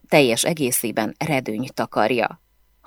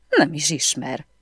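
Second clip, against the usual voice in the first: plain reading voice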